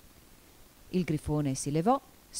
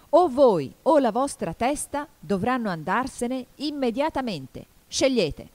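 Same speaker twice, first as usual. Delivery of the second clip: loud, raised-pitch royal ultimatum; imperious shout; 7 semitones higher, 6 dB louder than usual. A narrator's reading. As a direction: give the order as a furious queen would. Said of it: "gridò la regina pestando il suolo". (ultimatum gridato della regina)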